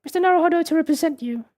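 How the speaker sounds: put-on high, polite young woman voice; young lady voice. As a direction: speak as a prim, proper young assistant. Voices Susato character.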